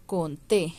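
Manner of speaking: Conté with a firm final -TÉ; con-TÉ, end stressed; stress on final syllable. Shown as a demonstration. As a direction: land on the last é of Conté. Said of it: "donde lleva el acento".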